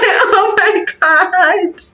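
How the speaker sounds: high, slow, dreamy gush; mock-swooning delight; gushing oh my God. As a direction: swoon over the words. Retelling her reaction to proposal.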